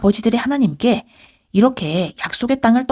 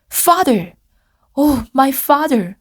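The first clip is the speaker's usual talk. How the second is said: weeping; 5 semitones higher, much slower than usual. Acted.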